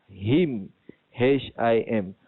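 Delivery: usual speaking voice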